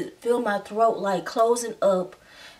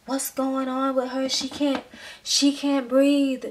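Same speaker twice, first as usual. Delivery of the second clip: mild mock yell; 5 semitones higher than usual. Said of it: "yell and scream at the nurses".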